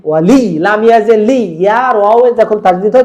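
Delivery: ordinary talk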